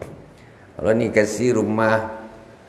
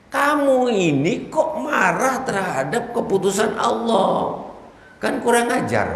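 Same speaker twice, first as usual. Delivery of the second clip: mock scolding; 12 semitones higher than usual. Preacher scolding the envious man, high and half laughing.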